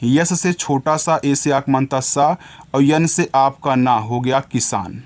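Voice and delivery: ordinary delivery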